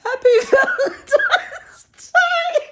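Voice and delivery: high-pitched giggly retelling, breaking into laughs; helpless high giggles while talking; hysterical laughter. Real, not acted. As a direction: tell it through real, rising hysterical laughter. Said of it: "I'm getting a little bit hysterical".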